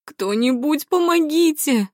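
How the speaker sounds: high, drawn-out cry; crying for help. A narrator's reading. Woman calls for help into the silence, crying out. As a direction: shout out desperately for anyone to come. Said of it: "закричала она".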